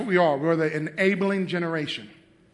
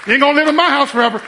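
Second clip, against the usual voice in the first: higher, loud, very fast telling off; stern shout; 9 semitones higher, 11 dB louder, much faster than usual. Retold or imitated. Dad telling his son he can't stay forever, loud and fast. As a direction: fire it at him fast. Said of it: "I said".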